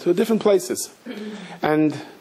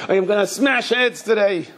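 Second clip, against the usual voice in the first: raised, higher-pitched threat in a retelling; mock-fierce vow, pitched up; retold angry yell; 6 semitones higher than usual. Riot cop vowing to smash heads, mildly acted angry yell. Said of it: "screaming and angry and yelling".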